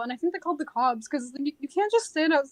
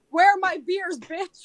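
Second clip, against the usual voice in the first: higher, louder mock demand; imitated screaming; 5 semitones higher, 4 dB louder, slower than usual. Drunk man to Chili's manager, imitated angry yell. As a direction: imitate an irate drunk demanding his property. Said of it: "like, screaming".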